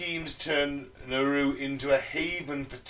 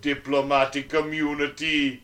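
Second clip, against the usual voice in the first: mock Russian accent; 4 dB louder than usual. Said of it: "wanna hear that Russian accent again".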